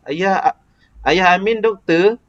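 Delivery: "Ayah Amin doktor" high and slow, rising at the end; asking intonation. Shown as a demonstration. Teacher modelling how to ask, asking intonation. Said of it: "kita nak macam nak tanyalah".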